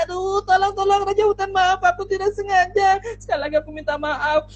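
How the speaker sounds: high, frantic begging; pleading mouse. Acted. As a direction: plead quickly for mercy. Tikus memohon ampun. (tikus memohon ampun dengan suara tinggi)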